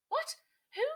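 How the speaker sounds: very high, loud shriek of alarm; startled shriek. A narrator's reading. Kia woken suddenly, shrieking in alarm. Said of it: "shrieked Kia".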